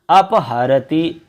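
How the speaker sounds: अपहरति drawn out syllable by syllable with a rise; teacher sounding out अपहरति very slowly; slow clear pronunciation. Shown as a demonstration. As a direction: stretch out अपहरति for the class. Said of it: "अप की ध्वनि आएगी".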